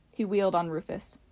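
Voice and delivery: plain reading voice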